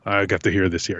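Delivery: usual speaking voice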